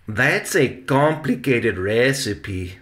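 ordinary delivery, nothing marked

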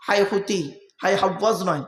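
ordinary talk, nothing marked

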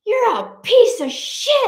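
high, loud outburst imitating an angry husband; retold angry yell. Husband yelling down at a litterer, angry mock yell. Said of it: "tattooed guy yelling at him".

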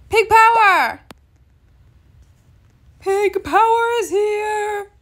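high, drawn-out excited call; excited hailing. Real, not acted. Gamer greets Pig Power in game, excited call. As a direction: call it out like announcing a hero.

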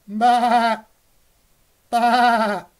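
high, loud bleating baa; mock sheep baa.

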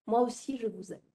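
usual speaking voice

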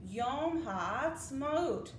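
Yom Ha'atzmaut said very slowly, syllable by syllable; slow careful pronunciation. Shown as a demonstration. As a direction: lead the children through Yom Ha'atzmaut slowly. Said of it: "Say it with me".

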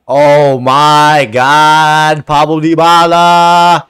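slow, drawn-out loud exclamation; awed celebration. Real, not acted. Gamer hailing his scorer Dybala, awed celebration. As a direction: stretch every word in loud amazement.

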